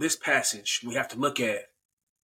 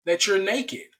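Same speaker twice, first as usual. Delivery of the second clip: high, incredulous questioning; God questioning; 7 semitones higher than usual. Acted. God asks how they know they are naked, incredulous. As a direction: press the question in rising disbelief. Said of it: "God's like".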